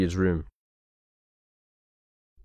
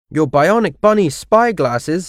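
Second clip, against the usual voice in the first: higher, eager echo of an offer; excited question; 6 semitones higher than usual. A narrator's reading. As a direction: ask it with pitched-up, keen interest. Arthur reacts to a tempting offer.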